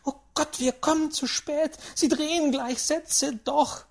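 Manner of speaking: put-on high-pitched, panicky old lady; agitated urging. A narrator's reading. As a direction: urge him on in alarm. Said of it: "ereiferte sich die alte Dame".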